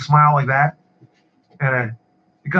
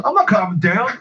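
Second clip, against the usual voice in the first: higher-pitched indignant retort, not truly shouted; mock screaming customer; 6 semitones higher than usual. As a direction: imitate a customer refusing to settle. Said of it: "he starts screaming at her".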